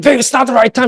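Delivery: high-pitched mock plea to a wife; comic, high-pitched husbandly objection; exasperated protest, retold.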